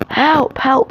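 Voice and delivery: high, loud acted cries for help; crying for help. A narrator's reading. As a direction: cry out in mock alarm, pitch way up. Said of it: "he cried".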